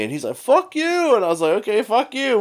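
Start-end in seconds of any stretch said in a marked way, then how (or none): 0.4-1.2 high, loud imitated scammer outburst; mock yelling. Scammer told he can't hang up, mock-angry yell. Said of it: "in his Indian accent or whatever". 1.6-2.4 high-pitched, playful comeback; mock retort. Caller answers scammer's insult, high mock retort.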